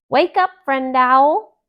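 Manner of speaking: loud, high call to a sleeping friend; shouted wake-up call. Acted. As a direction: shout him awake. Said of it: "Thumper shouted".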